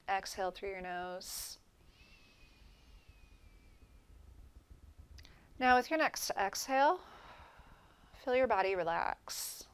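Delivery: calm meditation guide, long pauses between phrases; guided meditation. Real, not acted. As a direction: gently lead them through relaxing each body part, letting phrases settle into silence. Leads guided breathing relaxation meditation.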